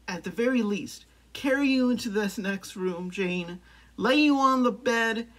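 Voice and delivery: building romantic declaration. Acted. Suitor vowing to be Jane's Tarzan, loud and grandly declaring. Climax of acted love declaration.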